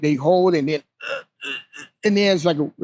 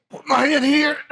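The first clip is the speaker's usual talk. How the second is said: tight, strained, breath held; holding breath; 10 semitones higher than usual. Shown as a demonstration. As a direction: say it while holding your breath. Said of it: "and then you know you hold your breath".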